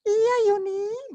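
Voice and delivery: very high, slow, delicate answer; mock tender falsetto; soft gentle reply. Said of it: "ngomong halus sekali".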